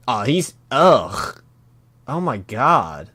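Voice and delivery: loud, slow drawn-out revulsion; slow disgusted reaction; real disgust. Real, not acted. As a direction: groan it out, grossed out. Reacting to gross vomiting panel.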